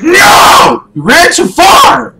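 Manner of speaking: high furious shout; real angry yell. Real, not acted. Player yelling as his character runs too far, furious. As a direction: scream at the character.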